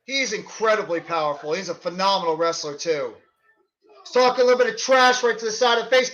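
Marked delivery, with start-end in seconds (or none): 4.0-6.1 fast play-by-play call; wrestling commentator. Acted. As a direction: rattle off the action quickly. Live play-by-play of match.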